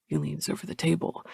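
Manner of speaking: ordinary delivery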